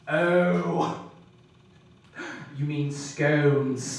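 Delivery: slow, low British know-it-all; unhurried, knowing friend imitation. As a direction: drawl the smug correction very slowly. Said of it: "he looks at me knowingly like".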